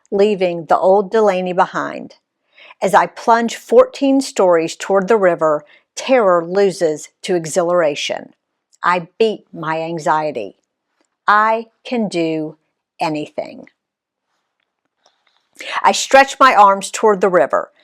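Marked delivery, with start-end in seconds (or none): none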